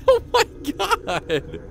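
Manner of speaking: slow, high "oh my god" through laughter; giddy laughing disbelief. Real, not acted. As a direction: laugh the words out, delighted. Laughing at the ride.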